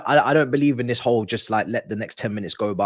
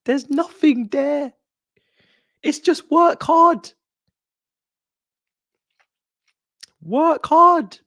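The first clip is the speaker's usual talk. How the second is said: much higher and louder, slow disbelief; exasperated outburst; 7 dB louder, much slower than usual. Real, not acted. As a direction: cry out in frustration at the team. Exasperated rant at the team.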